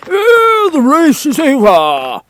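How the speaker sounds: high, loud, drawn-out announcement; Dodo calling out. Acted. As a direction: proclaim it like a pompous bird. Dodo calls out.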